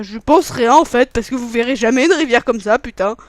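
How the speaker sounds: excited rant. Real, not acted. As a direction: burst out at the viewers.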